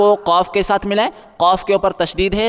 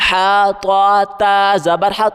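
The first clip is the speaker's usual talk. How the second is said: ordinary talk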